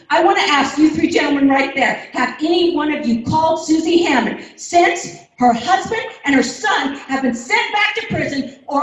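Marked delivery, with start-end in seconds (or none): none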